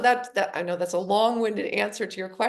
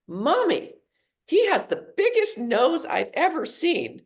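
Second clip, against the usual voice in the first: lightly voiced small child, higher and louder; a hint of an excited toddler blurting it out; little girl's voice; 7 semitones higher, 5 dB louder than usual. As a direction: daughter blurting to mom in the supermarket, mock childlike. Little daughter voiced in story.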